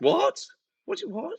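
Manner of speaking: high, rising incredulous questions; can't believe what you heard. Real, not acted. Disbelief at fence news.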